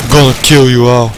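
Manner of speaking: ordinary talk, nothing marked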